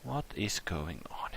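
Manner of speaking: hushed, slow, bewildered whisper; baffled whisper. Real, not acted. Player baffled after the sudden hang-up, slow whisper. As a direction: whisper it slowly in disbelief.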